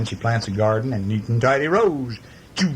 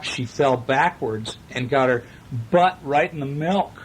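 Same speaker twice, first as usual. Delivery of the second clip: plain reading voice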